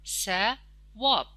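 drawn out very slowly; ثواب said very slowly for young pupils; slow demo word. Shown as a demonstration. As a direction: stretch ثواب out very slowly, syllable by syllable. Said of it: "چند هجا داره؟".

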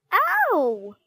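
loud, high yelp; pained squeal. A narrator's reading. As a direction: squeal as if hair is pulled. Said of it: "squealed Vain Violet".

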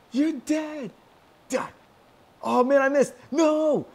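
high, loud battle yells; frantic yelling. Real, not acted. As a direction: yell in the heat of the fight. Frantic yelling in game fight.

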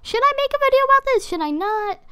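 high, fast squeaky inner voice; inner debate voice. Retold or imitated. Vlogger voicing her own dithering thoughts, squeaky and quick. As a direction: chirp the question to yourself. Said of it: "I was like".

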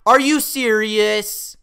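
high, loud, drawn-out disbelief; frustrated shout at the game; outraged yell. Real, not acted. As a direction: yell it slowly in exasperation. Raging at losing the game.

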